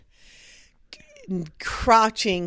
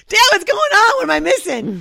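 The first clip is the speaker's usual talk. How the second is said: put-on much higher, louder child voice asking; curious little girl; 10 semitones higher, 7 dB louder than usual. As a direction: play the puzzled little daughter, high and loud. Voicing the youngest daughter.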